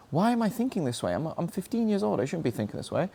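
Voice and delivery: ordinary delivery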